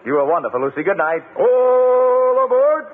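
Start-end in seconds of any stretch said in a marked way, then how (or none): 1.3-2.9 long drawn-out loud "All aboard"; train conductor call. Pretend. Host calling out conductor cry.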